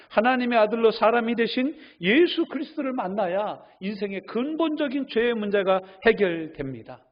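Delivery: loud, high preaching build; full-force preaching. Acted. Pastor preaching to the congregation, loud and rising. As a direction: drive the point home to the congregation.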